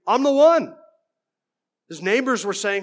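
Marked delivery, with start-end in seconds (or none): 0.1-0.7 loud, high-pitched protest of identity; insistent shout. Acted. Blind man insisting to doubting neighbors who he is, insistent shout. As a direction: call it out higher and much louder.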